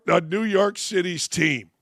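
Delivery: ordinary delivery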